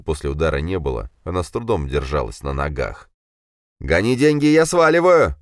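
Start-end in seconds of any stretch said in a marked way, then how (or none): 3.8-5.4 high, loud panicky shout; nervous cry. A narrator's reading. Дима panics and yells for money to escape. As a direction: yell it, losing nerve. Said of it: "вскрикнул Дима, начиная нервничать".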